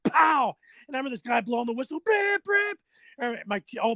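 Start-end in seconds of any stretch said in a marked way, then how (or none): 0.1-0.6 loud high punch sound effect; mock punch noise, loud and high; pow. Fight story, punch sound. 2.0-2.8 high whistle blasts from the mouth; sharp mimicked whistle, very high; whistle imitation.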